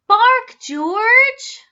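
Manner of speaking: high, loud call; proud call. A narrator's reading. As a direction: show him off. Mother shows off dog.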